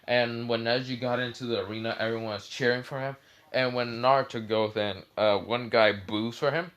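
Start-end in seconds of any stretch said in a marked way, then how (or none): none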